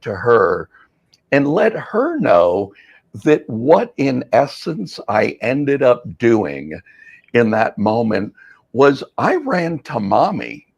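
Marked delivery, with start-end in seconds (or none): none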